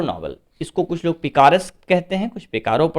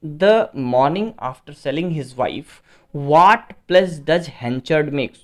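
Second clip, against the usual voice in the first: slow, spaced-out reading of the question; teacher reading the exam question slowly to the class; slow dictation; much slower than usual. Acted. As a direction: read each word out as if for dictation. Teacher reads quiz question slowly.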